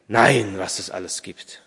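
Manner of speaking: a touch of pensive amazement; musing. A narrator's reading. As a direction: muse as if to yourself. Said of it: "sagte der alte Mann versonnen".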